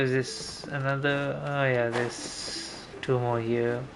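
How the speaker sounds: usual speaking voice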